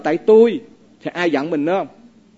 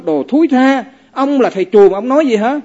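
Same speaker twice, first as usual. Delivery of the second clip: loud, higher indignant yelling; angry scolding; 5 semitones higher, 5 dB louder than usual. Acted. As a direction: snap back at the master. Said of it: "Nổi sân, ông chửi liền".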